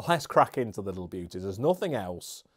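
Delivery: ordinary talk, nothing marked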